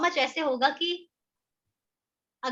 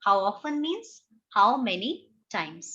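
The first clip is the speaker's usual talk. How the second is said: very slow, word by word; slow dictation to students; dictation pace; 2 semitones lower, much slower than usual. Shown as a demonstration. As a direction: dictate it slowly for notes. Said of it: "copy में लिख सकते हैं".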